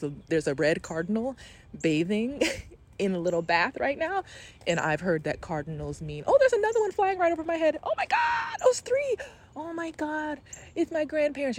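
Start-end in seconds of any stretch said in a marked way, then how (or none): 6.3-11.6 real gasping delight, pitch shooting much higher; overwhelmed joy at the sight of the birds; real excited surprise. Real, not acted. Host spotting cardinals she takes for her grandparents, thrilled. Cardinals suddenly appear overhead.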